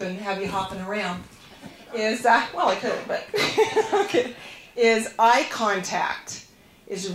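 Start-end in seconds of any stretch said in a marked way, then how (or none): 3.3-4.0 short, high-pitched laugh; quick real laugh at your own aside; high little burst of laughter; amused laugh. Real, not acted.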